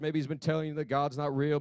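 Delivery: usual speaking voice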